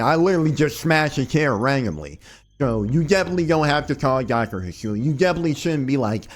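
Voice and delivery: ordinary delivery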